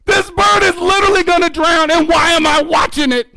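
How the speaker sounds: high, loud panicked shouting; hammed-up panic at full voice; freaking out. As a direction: cry out in horrified alarm. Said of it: "I am really freaking".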